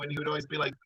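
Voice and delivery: ordinary delivery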